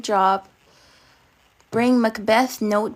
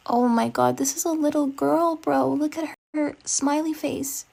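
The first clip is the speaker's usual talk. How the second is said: higher-pitched, softening, pained disbelief; pained pity; 5 semitones higher than usual. Real, not acted. Reader reacting to a little girl's diary, pained pity. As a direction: let pity lift the voice higher.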